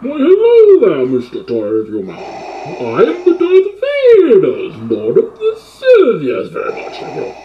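muffled villain voice through a mask; Bane voice. Acted. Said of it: "[name] in the Bane voice".

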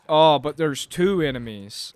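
usual speaking voice

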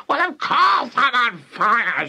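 put-on high, indignant old-woman screech; yelling. A narrator's reading. Grandma answering George about the smoke, yelling. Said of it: "she yelled".

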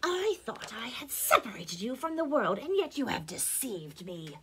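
witch voice, indignant; betrayed witch starting high, then dropping; raging witch. A narrator's reading. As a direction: scold as a wronged witch. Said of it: "In her rage".

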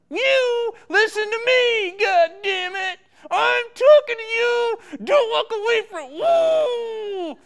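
loud, agitated outburst; angry tirade. Acted. Director demanding to be heard, angry yelling. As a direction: yell after someone who dares to leave. Acted tantrum at someone walking off.

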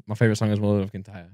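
low, quiet fake Scottish; Scottish accent, murmured low. Shown as a demonstration. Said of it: "I hope he's Irish then".

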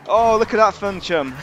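loud, high exclamation; amused outburst. Real, not acted. Player spotting a crash, loud amused outburst. Rider's crash seen in game.

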